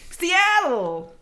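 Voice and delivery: high, loud, excited Seattle. Shown as a demonstration. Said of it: "you have to say it with excitement".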